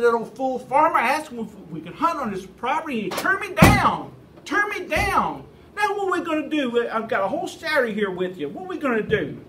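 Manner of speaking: loud, high-pitched mock rant; hammed-up indignant complaining; feigned outrage. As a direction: rage as a put-on to fool a friend. Pretended rant about being turned down.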